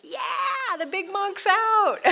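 high, loud mock cheer that breaks into a laugh; excited shout. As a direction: shout it out like a thrilled kid spotting the monk. Mocking excited neighbors' shout.

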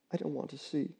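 barely above a breath, frightened; small voice. Acted. Neighbor hiding her face against Roy, small soft voice. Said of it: "she said in a small voice".

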